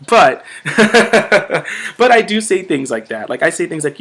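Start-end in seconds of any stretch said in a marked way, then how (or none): 0.5-1.9 bright laugh. Real, not acted. Laughs at own joke.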